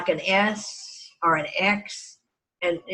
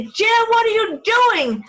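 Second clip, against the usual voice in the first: mother calling out; 14 semitones higher, 4 dB louder, slower than usual. Retold or imitated. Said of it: "she would always say".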